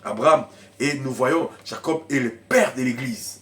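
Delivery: usual speaking voice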